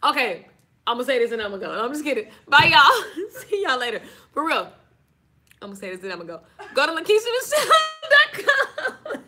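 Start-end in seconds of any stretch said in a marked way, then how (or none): none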